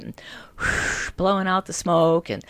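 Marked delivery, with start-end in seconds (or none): none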